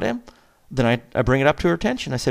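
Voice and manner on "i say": ordinary talk, nothing marked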